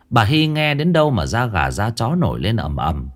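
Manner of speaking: ordinary delivery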